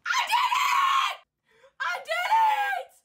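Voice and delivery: very high, loud victory cry; elated yell; triumphant shout. Real, not acted. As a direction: shout your win to the room. Victory in game.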